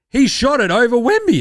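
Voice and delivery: loud, high-pitched imitation of broadcasters; mock excited commentator. Host mimics broadcast marvelling at a shot, mock excited call. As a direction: hype it up like an astonished commentator.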